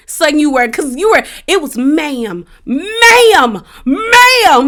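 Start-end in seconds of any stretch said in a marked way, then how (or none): 1.8-4.7 ma'am climbing higher and louder each time; angry rising ma'am. Retold or imitated. Sutton angrily ma'aming Anne-Marie, each louder. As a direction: hammer it harder with every repeat. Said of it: "Sutton was angry".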